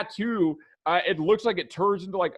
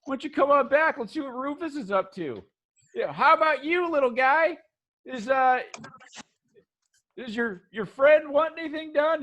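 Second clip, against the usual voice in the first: higher, friendly hustler voice; chummy shopkeeper pitching his services, pitched up; laid-back tattooed shop guy, higher; upbeat tattoo shop guy; 8 semitones higher than usual. Acted.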